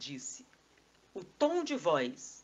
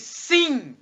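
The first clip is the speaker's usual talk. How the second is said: high, very loud acted yes; emphatic certainty; 7 semitones higher, 13 dB louder than usual. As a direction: shout it with total conviction. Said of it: "se você tiver certeza, diz".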